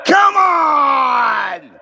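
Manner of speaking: frustrated shout. Real, not acted. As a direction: blow up in frustration. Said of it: "I lost my cool there a little bit".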